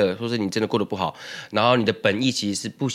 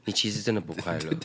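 knowing pity; 6 semitones lower, 8 dB quieter than usual. Retold or imitated. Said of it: "用一副就是那种".

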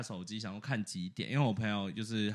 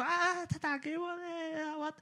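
much higher, slow squealing anime-girl impression; slower than usual. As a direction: squeal like a flustered anime schoolgirl. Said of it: "动漫的". (高八度的动漫少女腔)

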